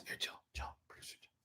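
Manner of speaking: very soft whisper off to the side; whispered aside. Real, not acted. Host calling producer Jill during the stream, real whisper. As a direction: whisper to get someone's attention discreetly.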